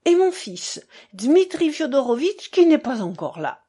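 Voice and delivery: a hint of an effusive father; lightly voiced buffoon father. Narrator plays the buffoon father.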